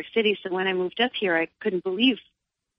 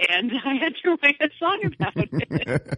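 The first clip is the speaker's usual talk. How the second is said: laughing through the words, higher and slow; 8 semitones higher than usual. Real, not acted. Mother telling the host why she wrote the song, laughing. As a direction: giggle it out, a little embarrassed.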